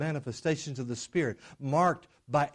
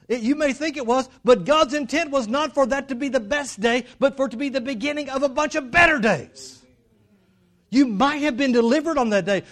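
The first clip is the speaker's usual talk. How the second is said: full-force preaching; 10 semitones higher, 9 dB louder than usual. Real, not acted. Preacher urging believers that better days are coming, full-force preaching. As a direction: preach it out to the whole room.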